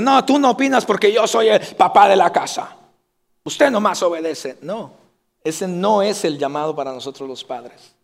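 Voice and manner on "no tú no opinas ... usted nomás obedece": bossy father. Retold or imitated. Authoritarian father voiced in sermon.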